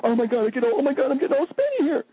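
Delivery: high, panicky mock fuss. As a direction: lampoon the fretful husband's panic. Mocking her panicked ex.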